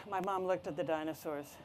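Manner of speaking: ordinary delivery, nothing marked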